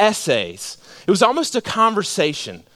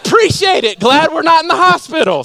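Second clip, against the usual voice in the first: mock thanks; 11 semitones higher, 7 dB louder than usual. Retells own loud mock-grateful reply.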